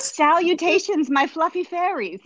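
mock Sarah Duff greeting. Host reading the guest's post greeting, playfully grand. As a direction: hail the crowd like her posts do. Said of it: "an actual Sarah Duff greeting".

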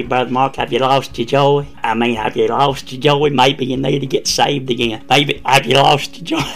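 a lightly voiced friend ribbing Brother Mark; needling old friend. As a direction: poke at him with a mock-solemn question. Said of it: "he'd say".

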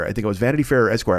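ordinary delivery

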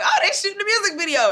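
high, quick, amused guess; oblivious chirp. Retold or imitated. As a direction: toss off the wrong guess brightly. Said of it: "And I was like".

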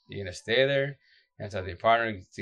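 usual speaking voice

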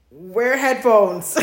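slow, loud and laughing; laughing warning. Real, not acted.

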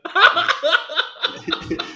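loud high burst of laughter; hysterical laugh. Real, not acted. Laughing after nothing to record.

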